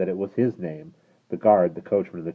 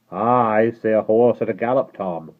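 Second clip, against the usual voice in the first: guard voice; 5 semitones higher, 8 dB louder than usual. Acted. Guard answering.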